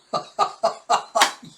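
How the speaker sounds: loud burst of laughter; real laugh. Real, not acted. Host amused by the falling scene, laughing.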